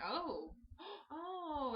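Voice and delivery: high gasp and startled oh; gasping in shock. Retold or imitated. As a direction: catch your breath in horror. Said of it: "you're like".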